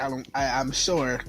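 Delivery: ordinary talk, nothing marked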